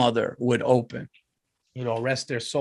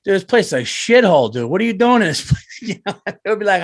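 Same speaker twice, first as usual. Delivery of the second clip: mock insult; 6 semitones higher, 6 dB louder than usual. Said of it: "I'd be like".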